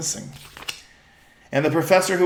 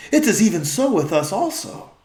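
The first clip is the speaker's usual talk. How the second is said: a touch higher, courtly reply; regal old-fashioned speech; 6 semitones higher, 4 dB louder than usual. Acted. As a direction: answer as one royal chorus. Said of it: "their voice has changed".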